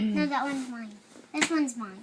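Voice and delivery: loud insistent claim. Real, not acted. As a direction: insist firmly. Siblings squabble over role.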